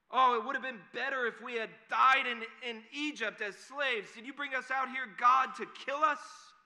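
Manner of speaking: loud, high-pitched wailing complaint to God; hammed-up grumbling. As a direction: cry out like an aggrieved, grumbling crowd. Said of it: "They start to grumble against Moses".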